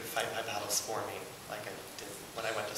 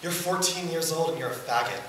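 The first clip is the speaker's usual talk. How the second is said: raised, higher self-accusing voice; harsh self-reproach; 6 semitones higher, 5 dB louder than usual. Retold or imitated. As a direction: scold yourself in the mirror. Quotes harsh words to himself.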